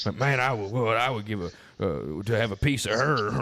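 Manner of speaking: put-on a light Alabama accent for the bar hooligan; hint of an Alabama drunk hitting on her; Alabama barfly. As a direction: lightly mimic a Southern Alabama guy leering at the wife. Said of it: "is an Alabama person".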